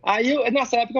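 ordinary talk, nothing marked

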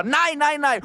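high-pitched cries of refusal, imitated; high mock shout; retold panicked yell. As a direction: mimic the dog trainer yelping in protest. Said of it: "mens hun råber".

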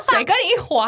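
high, loud indignant snap; indignant retort. Retold or imitated. Retelling her retort to the Shanghai taxi driver, indignant. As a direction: fire it back, offended. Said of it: "然后我想说".

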